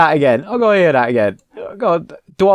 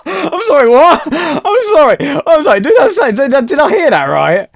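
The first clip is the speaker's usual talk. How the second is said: laughing disbelief; 13 semitones higher, 6 dB louder than usual. Real, not acted.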